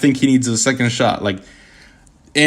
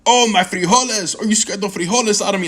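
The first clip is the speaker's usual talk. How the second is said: high, hammed-up cartoonish movie line; mocking a corny line from the movie; mocking George Lopez; 8 semitones higher than usual. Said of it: "George Lopez saying".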